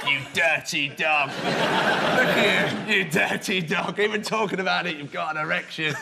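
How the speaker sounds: low-voiced leering rebuke; teasing accusation. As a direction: savour the mock scolding. Playfully accuses audience member.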